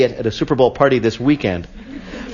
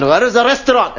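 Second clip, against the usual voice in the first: put-on Scottish accent; 6 semitones higher than usual. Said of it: "the people in Scotland are going".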